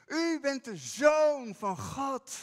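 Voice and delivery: loud, slow, ringing declaration; bold proclamation. Shown as a demonstration. Peter confessing Jesus openly before the disciples, loud proclamation. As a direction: proclaim it out loud with full conviction. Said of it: "zo kan je het niet zachtjes zeggen".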